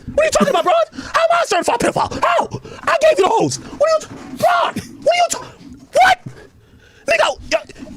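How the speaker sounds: high, rising disbelieving protest; LeBron in shock. Acted.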